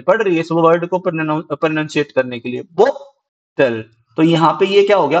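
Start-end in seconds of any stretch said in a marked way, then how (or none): 2.7-3.8 "bottle" very slow, stressed बॉ, pause, lower unstressed टल. Shown as a demonstration. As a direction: hit बॉ in "bottle", then let टल drop. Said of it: "टल में हमें ज्यादा, अ, फोर्स लगाने की जरूरत नहीं". ("बॉटल" में बॉ पर ज़ोर, टल हल्का)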